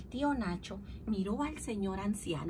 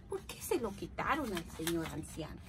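triste; 6 semitones lower, 8 dB quieter than usual. Acted. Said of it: "Estaba muy triste".

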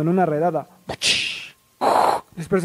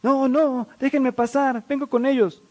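high, urgent mock plea; high-voiced protest to a guard; acted pleading; 7 semitones higher than usual. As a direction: beg to be let through, voice pitched up.

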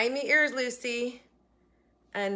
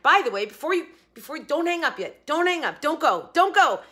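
normal talking voice

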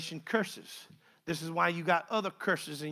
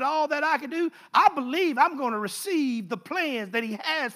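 preaching build, rising and louder; 4 semitones higher, 7 dB louder than usual. Acted. Preacher building to his congregation, rising fervour. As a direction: build the testimony toward a shout.

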